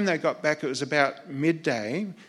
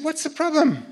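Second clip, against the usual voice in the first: high, easy, half-amused question; mayor's casual question; 12 semitones higher, 6 dB louder than usual. Retold or imitated. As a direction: shrug the problem off as the new premier. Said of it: "And he said".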